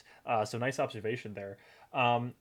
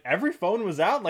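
higher, louder incredulous question; mock disbelief; 7 semitones higher, 6 dB louder than usual. Host mocking Indy's phone excuse, incredulous. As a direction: scoff at a flimsy excuse.

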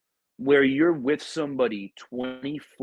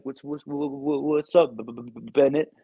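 imitating a stutter. Marine retells his stroke-hit buddy stuttering his name. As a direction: mimic a stroke survivor stuttering out a greeting. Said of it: "this dude's stuttering".

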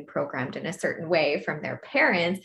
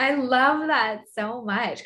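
high and delighted; 6 semitones higher, 5 dB louder than usual. Real, not acted. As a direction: gush with delight. Delighted listener response.

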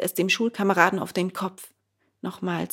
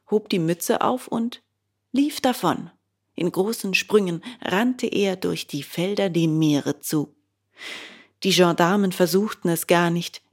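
plain reading voice

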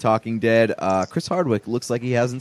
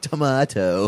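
mock echo, "Tomato" pitched up and exaggerated; 4 semitones higher, slower than usual. Guy at bar mocks girl's accent, higher "Tomato". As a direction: throw "Tomato" back higher, making fun of the accent. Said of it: "like, mocking her".

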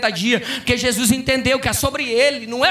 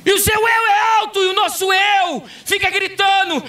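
fiery preaching; 10 semitones higher than usual. Acted. Pastor rebuking the congregation's ego, fiery full-force preaching. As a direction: preach it hard to the church.

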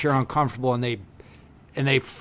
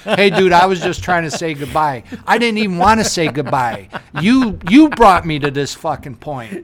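loud, pressing, slowing at the end; mock angry rant; 2 semitones higher, 7 dB louder than usual. Acted rant at a guest who pushed too far, loud. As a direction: vent like you've been pushed too far.

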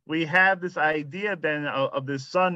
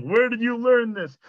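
confronting father; 9 semitones higher, 4 dB louder than usual. Retold or imitated. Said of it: "where the father confronts the son".

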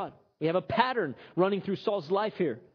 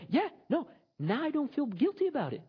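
cheerful punchline; 8 semitones higher than usual. Acted. Punchline from the customer.

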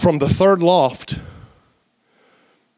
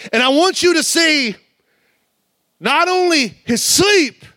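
preaching at full force; 8 semitones higher than usual. Acted. Preacher rousing the congregation about the sleeping generation. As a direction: drive it home to the congregation.

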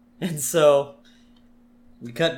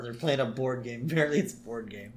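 usual speaking voice

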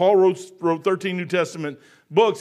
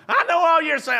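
higher, louder mock mother; imitating mom; 9 semitones higher, 4 dB louder than usual. Son mimicking his ninety-year-old mom, higher and dismissive. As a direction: toss it off like a stubborn mother. Mom voiced in retold argument.

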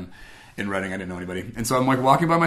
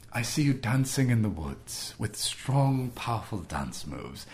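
put-on a calm British accent from the back of the mind; 5 dB quieter, slower than usual. Retelling the inner voice in the woods, slow British accent. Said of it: "I heard this British voice".

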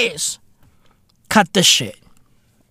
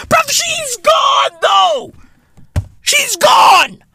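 high shouting rant. Real, not acted. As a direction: yell it at the top of your voice. Shrieking rant about aunt.

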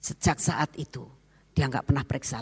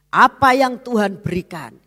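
loud, firm proclamation of faith; loud and sure; resolute declaration; 4 semitones higher, 10 dB louder than usual. Retold or imitated. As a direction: declare it boldly. Ririn's resolute declaration quoted.

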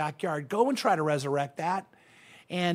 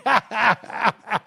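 amused laugh at himself; 4 semitones higher, 3 dB louder than usual. Real, not acted. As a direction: chuckle at your own slip. Laughs at own dated word.

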